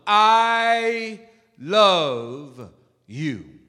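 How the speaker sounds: long-held, separated words; emphatic declaration. Shown as a demonstration. Preacher showing the congregation how to say it, slow and emphatic. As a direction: draw out each word slowly. Models saying it fully.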